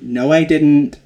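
loud indignant denial, retold; mock explosive retort. Host retelling Joy's furious reply to Kim, loud and indignant. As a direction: blast it back, hammed-up. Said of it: "Joy explodes back at her".

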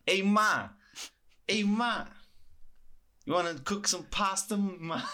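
loud, high New York Italian voice; Sopranos mobster. Retold or imitated. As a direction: yell to mom like a Jersey mobster. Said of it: "reminded me a lot of characters from The Sopranos".

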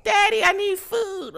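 put-on high, slow little kid voice; child voice. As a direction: whine it like a hungry little kid. Voicing a future child.

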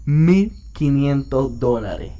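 very slow, each word dragged out in disbelief; stunned reading. Retold or imitated. Reading out the fine amount to his friends, very slowly and stunned. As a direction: read the number like you can't believe it.

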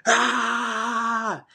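sudden high wordless yell; retold scream. Runner to his pacer mid-race, releasing built-up tension with a scream. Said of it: "Bro, I gotta scream".

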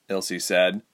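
plain reading voice

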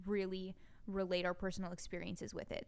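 normal talking voice

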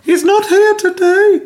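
high, slow falsetto wife; fake wife voice. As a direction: squeak out a fake woman's voice. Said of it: "Definitely how she sounds".

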